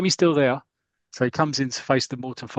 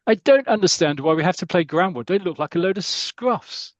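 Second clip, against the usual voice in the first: higher, louder posh schoolboy sneer; posh boys; 5 semitones higher, 4 dB louder than usual. Acted. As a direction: look down your nose in a posh voice. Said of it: "that's my impression of a posh boys".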